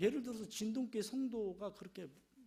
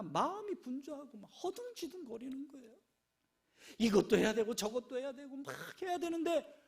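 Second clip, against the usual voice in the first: ordinary delivery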